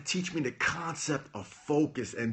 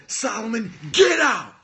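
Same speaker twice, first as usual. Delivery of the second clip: loud, high yelled order; screaming get out; 10 semitones higher, 9 dB louder than usual. Retold or imitated. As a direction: scream him out of the office. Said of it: "And he screamed at me".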